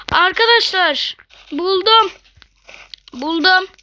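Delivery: loud, high excited cry of a find; thrilled to have found it; shouting out a lucky find. Real, not acted.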